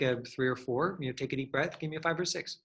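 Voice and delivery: ordinary talk, nothing marked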